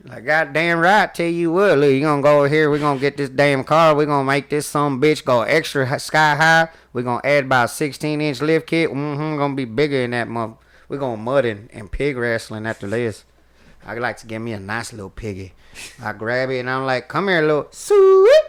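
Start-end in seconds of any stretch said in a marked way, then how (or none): 0.2-15.5 put-on loud, rowdy country-boy accent, much louder; hammed-up country accent hyping a truck. Showing off his stage impression, in a loud country accent. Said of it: "I could do ... the country". 17.1-18.4 put-on much higher and louder, slow baby-talk coo; cooing to a piggy. Grabbing the pig on stage, in a high loud coo. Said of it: "my little act outs".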